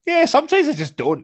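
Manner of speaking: breezy shrug. Retold or imitated. Acting out his lazy reply.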